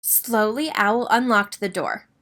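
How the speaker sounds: ordinary delivery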